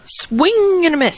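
high-pitched singsong play-by-play; sportscaster call. Pretend. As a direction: call the miss like a ballgame announcer. Play-by-play call of missed kick.

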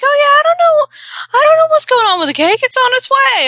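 very high, loud mock cluelessness; panicked bluffing. Husband bluffing to wife about the missing cake, panicked bluffing. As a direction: squeal the cover story, hammed-up.

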